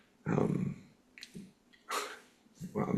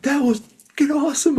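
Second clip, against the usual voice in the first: very high, loud retold elation; thrilled; 5 dB louder than usual. Young man after helping the old woman, thrilled. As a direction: imitate a friend buzzing with amazement.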